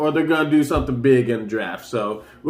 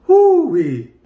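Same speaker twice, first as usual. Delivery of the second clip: high, loud whoo-wee; excited whoop; 10 semitones higher, 6 dB louder than usual. Real, not acted. Vlogger pictures Wizards facing Nets, hollering with excitement. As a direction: let out a thrilled holler.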